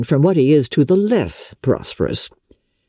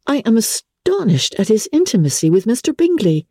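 put-on higher young woman's voice, indignant and shocked; Elizabeth, indignant; 8 semitones higher than usual. A narrator's reading. Elizabeth to Wickham, outraged at Darcy's pride, higher indignant voice. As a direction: play a young lady outraged on his behalf.

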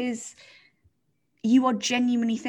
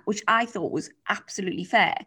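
ordinary delivery

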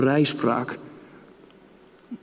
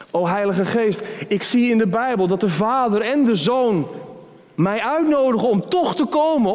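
higher, rising, wondering prayer; eager sinner's prayer; 10 semitones higher than usual. Acted. Preacher as a sinner addressing the Holy Spirit, higher and amazed. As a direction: marvel at the invitation, voice lifting.

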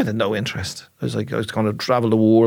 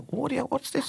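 a soft, much higher, hushed puzzled voice; puzzled inner voice; 12 semitones higher, 10 dB quieter than usual. Retold or imitated. His inner voice asking him on stage, hushed and higher. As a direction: let the inner doubter ask quietly, up high. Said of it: "it's asking me, like, kinda".

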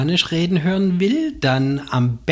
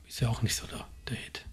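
whispered aside; 15 dB quieter, faster than usual. Real, not acted.

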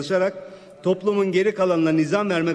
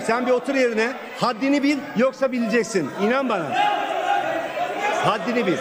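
angry; 5 semitones higher than usual. Real, not acted. Threatening heckler in parliament.

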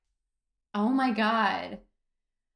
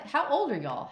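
mock disbelief; 8 semitones higher than usual. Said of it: "you're like".

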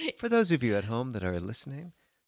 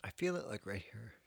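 real hushed whisper, softer; 3 semitones lower, 11 dB quieter than usual. Real, not acted. Co-host whispers how the sour drink burns. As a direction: breathe it out.

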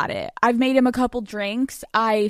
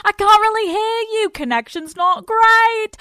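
high, loud put-on voice; fake bad connection; 12 semitones higher, 5 dB louder than usual. Pretending to the therapist the call is breaking, high and loud.

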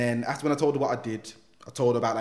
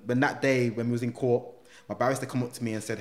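normal talking voice

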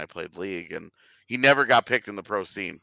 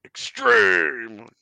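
high, loud extreme-sports hype; hype announcer; 10 semitones higher, 9 dB louder than usual. Pretend. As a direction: belt it like an X Games ad. Mock hype word.

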